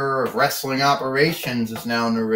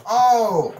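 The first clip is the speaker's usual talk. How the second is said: higher, louder Oh; surprised shout; 6 semitones higher, 5 dB louder than usual. Pretend. Commentator watches the beatdown, startled cry. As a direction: react to the crash. Commentator reacts to big hit.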